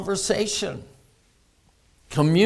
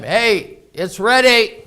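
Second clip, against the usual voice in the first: loud, higher dinner call; raised voice calling to another room; imitated yell; 5 semitones higher, 6 dB louder, slower than usual. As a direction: mimic calling out that food's ready. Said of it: "Debbie yells out at me all the time".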